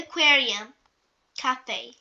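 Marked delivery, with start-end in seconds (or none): none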